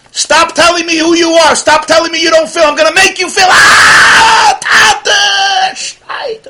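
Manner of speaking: high, loud forceful shout; screaming at yourself. Retold or imitated. A worshipper screams at himself to feel, desperate shout. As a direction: yell it to force the feeling out. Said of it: "You're trying to scream at yourself".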